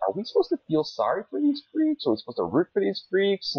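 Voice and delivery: high, incredulous mock question; mock disbelief, pitched far up. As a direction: sarcastically ask it way up high. Mocking the show's message.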